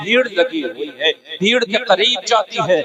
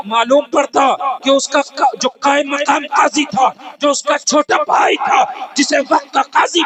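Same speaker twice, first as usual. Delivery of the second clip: preaching at full force; 5 semitones higher than usual. Acted. Preacher telling crowds of her healing prayers, full cry. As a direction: build the miracle to a peak.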